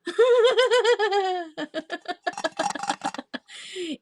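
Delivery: long high burst of laughter; high giggle. Real, not acted. Seller cracking up at her own misreading, giggling. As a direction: laugh helplessly at the mistake.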